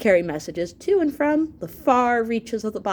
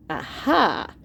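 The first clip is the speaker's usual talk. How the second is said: aha; 3 semitones higher than usual. Acted. Host finds letter from brain.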